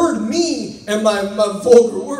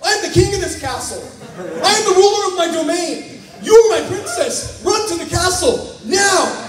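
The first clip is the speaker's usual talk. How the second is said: hammed-up heroic shout; 9 semitones higher than usual. Heroic husband commanding his wife.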